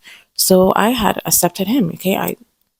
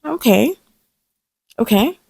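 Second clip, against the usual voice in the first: sly okay; 4 semitones higher than usual. Retold or imitated. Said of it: "I'm like".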